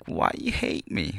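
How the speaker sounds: slow, breathy whisper; whispered plea. Retold or imitated. Asking the owner who hates him, in a slow whisper. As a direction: mutter it under your breath, hurt.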